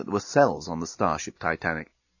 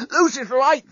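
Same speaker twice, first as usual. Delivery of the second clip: out of breath, high-pitched; winded, breath heard before the words; panting; 14 semitones higher than usual. A narrator's reading. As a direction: gasp it out mid-fight. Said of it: "the journalist panted".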